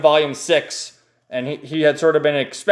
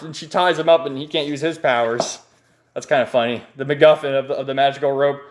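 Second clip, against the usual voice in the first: normal talking voice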